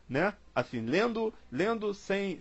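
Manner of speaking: ordinary talk, nothing marked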